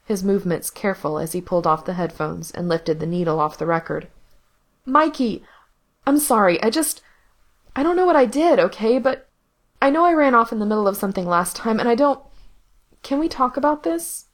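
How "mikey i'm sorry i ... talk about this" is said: higher, louder, anxious pleading rush; a younger brother begging to be heard, voice pitched up; Gerard pleading. Acted. Gerard begs his brother Mikey to talk, higher and pleading.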